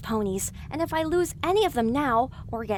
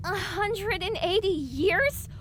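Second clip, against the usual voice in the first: higher, slow rising question of shock; stunned disbelief; 7 semitones higher, slower than usual. Acted. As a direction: let the number hang in disbelief. Shocked at the news.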